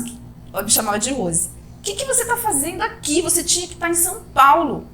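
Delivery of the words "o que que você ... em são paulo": high, startled question from a worried friend; surprised friend. Retold or imitated. Friend on the phone, shocked she isn't in São Paulo, surprised. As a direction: imitate the friend's shock at seeing her home.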